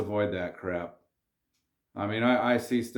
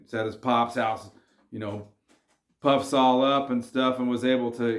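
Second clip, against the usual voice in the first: ordinary talk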